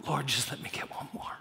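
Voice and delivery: soft, half-whispered plea; hushed prayer. Acted. Preacher takes up the rescuer's prayer for his community, hushed plea. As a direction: pray it quietly, almost under the breath.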